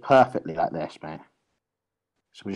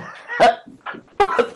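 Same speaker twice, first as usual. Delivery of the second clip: high airy laugh, nearly silent; breathless laughter; 4 dB louder than usual. Real, not acted. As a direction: laugh so hard the voice drops out. Laughing through the argument.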